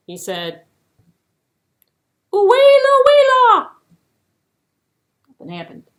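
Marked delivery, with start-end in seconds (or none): 2.3-3.7 high, loud hopeful shout; high loud guess. Acted. Eland shouting a wrong name at the tree.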